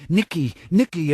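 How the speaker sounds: narrated scream; mock scream. A narrator's reading. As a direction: cry out for help. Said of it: "I screamed".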